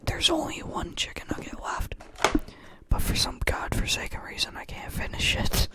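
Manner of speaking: breathy whisper of dismay; whispered complaint about the last nugget. Real, not acted. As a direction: hush it like a secret confession.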